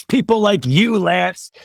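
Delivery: high, loud mocking emphasis; mock scorn. Real, not acted. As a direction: throw the critics' label back, amused. Mocking critics' view.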